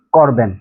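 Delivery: করবেন low and falling, two syllables; colloquial form. Shown as a demonstration. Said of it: "চলিতে এসে হয়ে যাবে". (করবেন নিচু স্বরে)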